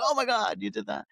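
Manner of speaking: amazed. Retold or imitated. People amazed by the cover.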